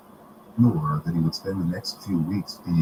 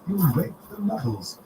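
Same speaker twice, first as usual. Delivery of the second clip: put-on stern old woman voice; 12 semitones higher than usual. A narrator's reading. McGonagall scolding Hagrid.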